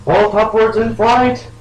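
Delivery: high, loud, drawn-out battle cry; called-out action. Pretend. Player calling out a pony's escape move, high heroic shout.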